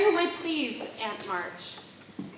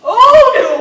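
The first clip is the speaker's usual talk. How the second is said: startled gasp; 7 semitones higher, 16 dB louder than usual. Acted. Startled by his proposal.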